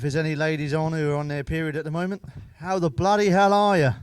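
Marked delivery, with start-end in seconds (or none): 2.6-3.9 higher, louder mock-hearty greeting; loud joking hello; cheeky shout. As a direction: bellow it out cheekily.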